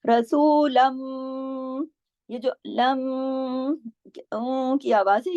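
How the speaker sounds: रसूलम extremely slow, the mim hummed through the nose; ghunna demo. Shown as a demonstration. Teacher showing nasal ghunna to students, slow chant. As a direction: hum the end of रसूलम. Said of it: "ये गुन्ना है जो नाक में".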